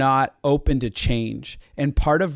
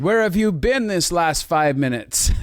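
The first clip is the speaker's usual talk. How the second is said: mock lovesick; 5 semitones higher, 3 dB louder, slower than usual.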